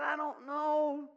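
high, drawn-out mock wail; retold crying. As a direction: plead helplessly like a tearful wife, hammed up. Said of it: "She cried".

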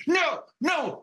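high-pitched retold cry of refusal; mock scream. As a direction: cry out like two screaming boys. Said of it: "me and my brother are screaming".

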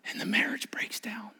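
breathy whispered aside; hushed, grave whisper. Real, not acted. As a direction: drop to a whisper to let it land. Hushed aside mid-sermon.

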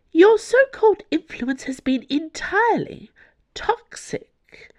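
high-pitched scornful cardinal; imperious, high angry voice; angry retort. A narrator's reading. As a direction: spit back at a rival, pitched high, dropping off on the last word.

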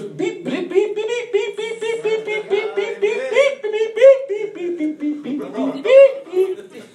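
high-pitched imitated backup beeps; string of high censor-style beeps; truck reversing beeper. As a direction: beep rapidly like a reversing truck, covering up swearing. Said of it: "a truck backing up".

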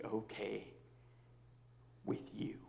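whispered confession. Real, not acted. Pastor confessing to his church in a whisper. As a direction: barely breathe the words.

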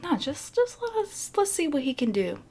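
lightly voiced excited skater; eager kid. Kid urges watching Dion.

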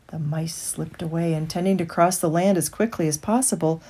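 usual speaking voice

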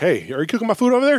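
loud, high call across the room; customer calling out. Acted. Customer calls to grill.

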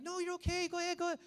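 high, loud reassurance; urging parent. Retold or imitated. Preacher as parent at the poolside, urging. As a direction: imitate a parent pushing a child onward. Parents urge the crying child on.

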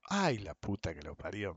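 low, soft retold swearing; soft muttered retelling of a trader's curse. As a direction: mutter the friend's curse under your breath. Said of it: "me dice".

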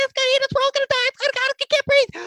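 loud, very high goblin in full panic; panicking Boogers. Acted. Goblin facing a dragon, panic attack voice. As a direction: squeal as if about to die, gasping for air. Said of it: "have Boogers have a panic attack".

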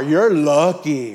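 loud, drawn-out grudging praise; mock envy. Friend answering news of a new car, mock envy. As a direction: stretch each word with exaggerated envy.

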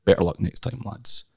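ordinary talk

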